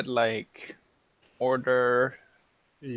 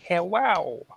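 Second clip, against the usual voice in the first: put-on cutesy baby voice; 6 semitones higher, 4 dB louder than usual. Shown as a demonstration. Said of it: "Close enough".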